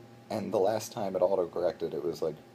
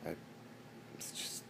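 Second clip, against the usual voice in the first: mock sorrow; 5 semitones lower, 13 dB quieter than usual. Mock sorrow, everyone leaves.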